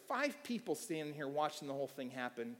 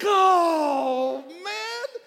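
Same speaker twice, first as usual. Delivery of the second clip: loud crying wail, much higher; mock-tearful howl of regret; anguished lament; 14 dB louder, much slower than usual. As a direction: wail in frustration at just missing it. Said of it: "I was like".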